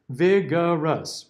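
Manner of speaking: vigorous drawn out slowly, each syllable clear. Shown as a demonstration. Teacher slowing the word down so students hear the schwas. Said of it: "and then two schwas".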